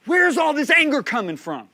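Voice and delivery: high, loud, exasperated cry to God; angry outcry. Retold or imitated. Man at the prayer grounds crying to God, angry outcry. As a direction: retell it as a frustrated shout at heaven. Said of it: "I've never been angry like this".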